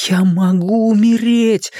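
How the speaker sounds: high, drawn-out cry of horror; terrified inner cry. A narrator's reading. Richie's mind realising death, crying out in horror. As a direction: cry it out slowly in wild terror. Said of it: "в диком ужасе закричал его рассудок".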